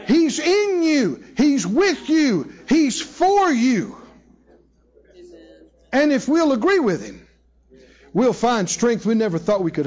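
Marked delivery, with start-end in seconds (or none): 0.1-4.0 slow, high, one phrase at a time; slow emphatic. Acted. Preacher assuring listeners God is in them, slow and emphatic. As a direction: weigh each short line.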